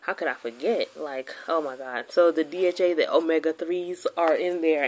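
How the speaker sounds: normal talking voice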